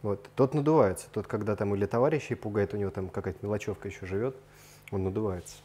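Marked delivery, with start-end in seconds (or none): none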